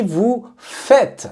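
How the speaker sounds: vous faites said slowly and clearly; correct form. Shown as a demonstration. Teacher correcting students with slow vous faites. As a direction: stress faites, slow and deliberate. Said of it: "on dit".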